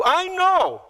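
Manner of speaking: high-pitched, mock-certain declaration; know-it-all insistence. Martha answering Jesus, high know-it-all insistence. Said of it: "Martha twice".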